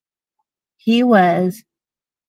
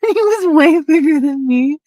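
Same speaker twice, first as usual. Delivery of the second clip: high-pitched, laughing aside; real laughing; 7 semitones higher than usual. Real, not acted. Recalling the fight with a bigger ex, laughing. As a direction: barely get it out for laughing.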